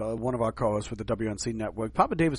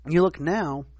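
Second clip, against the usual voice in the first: ordinary delivery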